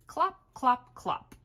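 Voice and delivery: clip-clop hoofbeats; horse hooves. Acted. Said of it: "He can sound like horse feet".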